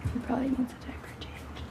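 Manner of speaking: soft unvoiced whisper. Real, not acted. Mother whispering an aside about her baby, whispered.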